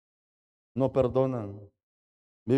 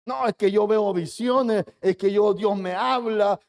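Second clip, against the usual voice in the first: boastful mimicry; 5 semitones higher than usual. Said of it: "y comienza a alardear de su espiritualidad".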